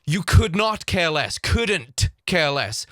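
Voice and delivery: slow, NOT and COULDN'T punched; emphatic correction. Shown as a demonstration. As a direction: spell out COULD NOT word by word. Correcting the phrase, slowed down.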